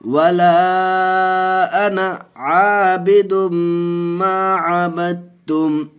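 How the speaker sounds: slow, high, drawn-out chanted recitation; Quranic recitation. Shown as a demonstration. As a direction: intone each syllable long and melodic like tajweed recitation. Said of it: "गुन्ना करके पढ़ेंगे".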